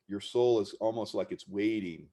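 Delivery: ordinary talk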